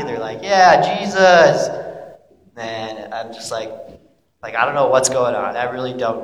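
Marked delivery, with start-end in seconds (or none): 0.4-1.7 high, loud, drawn-out cheer; happy cheering. Acted. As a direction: imitate a delighted crowd member. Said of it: "they're, like, happy".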